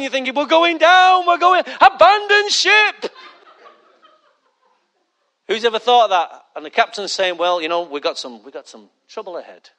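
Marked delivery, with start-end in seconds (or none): 0.3-3.1 high, loud, drawn-out alarm; mock panic. Panicked passenger as the plane drops, hammed-up alarm. 7.4-9.7 low, soft, soothing announcement; nice captain voice. Acted. Captain warning passengers of trouble, nice soft voice. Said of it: "He says it in a real nice voice".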